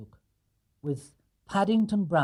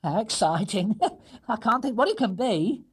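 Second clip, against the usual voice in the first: higher, chuckling anticipation; higher, laughing housekeeper; excited laugh; 8 semitones higher, 3 dB louder than usual. Acted. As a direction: laugh while unwrapping. Mrs Bird unwraps.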